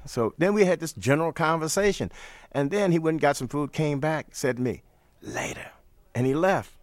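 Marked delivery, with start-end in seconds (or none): 5.2-5.7 low, soft throwaway goodbye; imitating Miles's low, raspy farewell; low rasp. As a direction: drop it low and leave.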